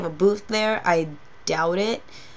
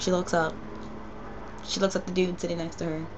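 usual speaking voice

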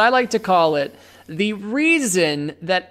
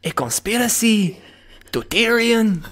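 put-on Spanish accent. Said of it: "My sexy Spanish accent".

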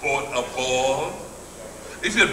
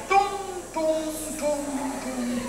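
much higher, softer plinks like drops landing; plinking sound effect; 12 semitones higher, 8 dB quieter, slower than usual. Retold or imitated. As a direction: tap out tung tung like gems dropping. God placing islands in the sea.

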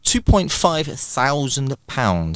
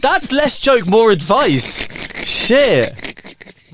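high, amused reaction; 10 semitones higher, much slower than usual. Real, not acted. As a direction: laugh off the joke. Reacting to dirty joke.

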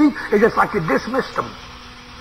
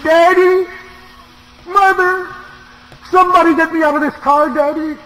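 preacher voicing a trapped girl's panicked scream; retold girl's screams; 11 semitones higher than usual. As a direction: scream for daddy like a trapped girl. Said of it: "that little girl began to scream".

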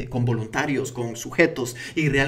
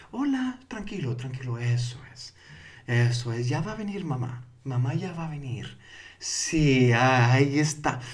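tono y ritmo para arrullar. Shown as a demonstration. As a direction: lull a restless baby to sleep, hushed and tender. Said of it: "Eso es tono y ritmo". (voz suave de arrullar a un bebé)

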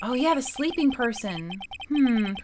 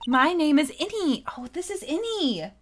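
put-on high, loud valley girl voice; man playing a bubbly valley girl; 5 semitones higher, 3 dB louder than usual. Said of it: "Like, Valley Girl's good for this".

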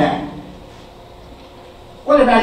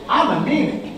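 normal talking voice

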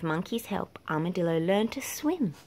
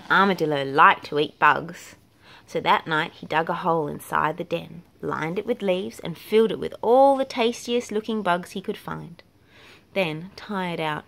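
plain reading voice

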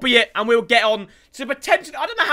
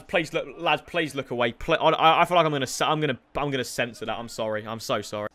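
ordinary talk, nothing marked

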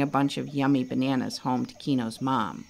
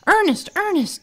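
high, loud calling out; yelling; 14 semitones higher, 6 dB louder than usual. A narrator's reading. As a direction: call his name across the forest. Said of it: "Toucan Tom was yelling".

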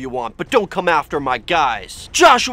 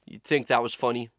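deadpan quiet aside; 6 semitones lower, 9 dB quieter than usual. Real, not acted. Deadpan aside after skit.

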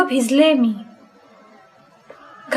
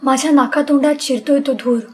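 ordinary delivery

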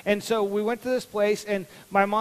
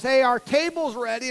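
mom calling out; 5 semitones higher, 5 dB louder than usual. Retold or imitated. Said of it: "My mom yells".

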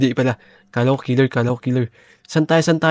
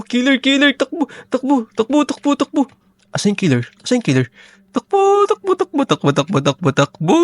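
frantic run yell; 11 semitones higher, faster than usual. Acted. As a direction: shriek to the others to flee the killer. Killer spotted, yells to run. (takot na sigaw na takbo)

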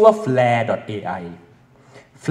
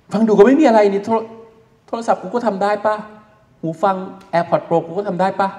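higher, mocking doubtful voice; scoffing skeptic; 7 semitones higher than usual. Teacher voicing a doubting student, scoffing high. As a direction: scoff like a kid who thinks it's nothing special.

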